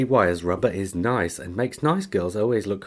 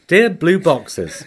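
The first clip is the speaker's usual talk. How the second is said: mock formal; 8 semitones higher, 5 dB louder, much slower than usual. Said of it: "Makes me sound childish".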